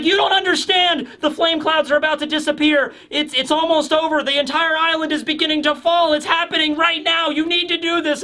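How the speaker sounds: high, pressing urgent voice; urgent high pleading. Retold or imitated. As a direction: push him to act now, high. Voicing Yamato pleading urgently.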